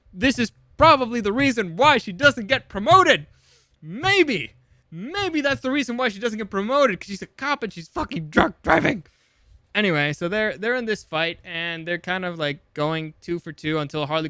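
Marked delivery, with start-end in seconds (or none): 0.1-9.1 high, loud incredulous outburst; emphatic, high-pitched comic indignation; exasperated rant. Real, not acted. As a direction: let the exasperation boil over. Exasperated rant about drunk-driving cop.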